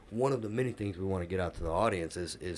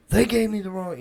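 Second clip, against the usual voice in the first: sharply higher, louder mock outburst; cut-off angry retort, higher and louder; half-started yell; 12 semitones higher, 7 dB louder than usual. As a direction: blurt it like you're about to snap back. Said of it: "to turn around and yell".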